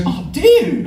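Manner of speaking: very high imitated exclamation; dismissive instructor. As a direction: coo it high as the unbothered instructor. Instructor reacts to jellyfish, acted quote.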